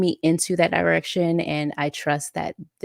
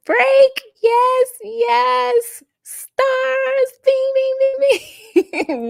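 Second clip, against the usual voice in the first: much higher, louder playful cheer; cheery comment read; 8 dB louder, much slower than usual. Retold or imitated. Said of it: "Jeanette says".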